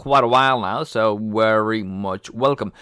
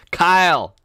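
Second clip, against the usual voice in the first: louder, bright call-out of a name; excited call; 7 semitones higher, 6 dB louder than usual. Real, not acted. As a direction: streamer shouts a viewer's name in welcome. Greeting a new viewer.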